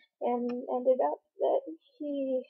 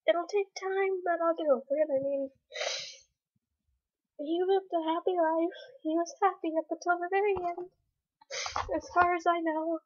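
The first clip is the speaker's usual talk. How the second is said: tearful, voice higher and breaking; crying; 5 semitones higher than usual. Real, not acted. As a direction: push the words through tears. Crying about her lost dog.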